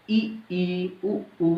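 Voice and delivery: ई, ई, उ, ऊ said with lips nearly closed, held clearly; vowel demo. Shown as a demonstration. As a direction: demonstrate the closed vowels ई and ऊ slowly. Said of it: "इसका उच्चारण देखिए".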